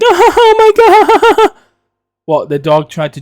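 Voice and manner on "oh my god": very high, loud screeching laugh; hammed-up helpless cackling, long drawn out; mimicked hysterics. Everyone howling at a falling dog, loud high mock hysterics.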